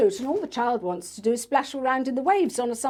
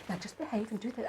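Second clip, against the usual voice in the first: mum's much softer, hushed warning at the school gate; 13 dB quieter, much faster than usual. Retold or imitated. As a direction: mum hushing her child at drop-off, under her breath. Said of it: "the mum's sort of going".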